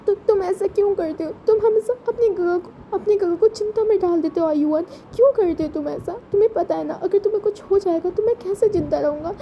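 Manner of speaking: anguished big brother, voice up high; tearful scolding brother. Acted. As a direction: scold him because you were terrified for him. Wing scolds Ayuan tearfully.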